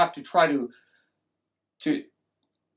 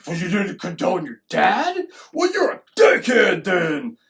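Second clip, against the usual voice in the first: drawn-out, louder mock insult building at the end; mock scolding; 5 dB louder than usual. Mob rejecting the son who won't condemn, mock scolding. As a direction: round on them in acted disgust.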